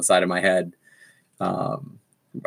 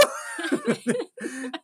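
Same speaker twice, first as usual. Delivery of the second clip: high giggle; 9 dB quieter than usual. Real, not acted. Laugh after swooning quote.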